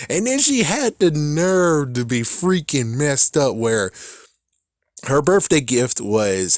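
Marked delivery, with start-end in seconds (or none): none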